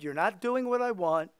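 ordinary talk

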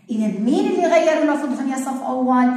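ordinary talk, nothing marked

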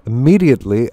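ordinary delivery

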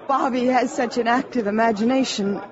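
ordinary talk

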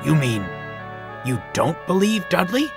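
hopeful question climbing high at the end. A narrator's reading. Bear asking whether Donald doubts Dudley, hopefully. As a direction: ask with a hesitant, rising hope.

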